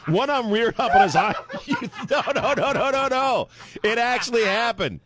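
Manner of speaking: high-pitched, worked-up protest; excited insistence. Real, not acted. Storyteller swearing to laughing co-hosts it happened, high and worked up.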